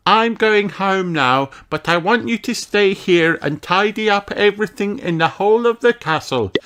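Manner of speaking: put-on a little boy instructing the robot; elf boy giving orders. A narrator's reading. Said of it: "says Ben".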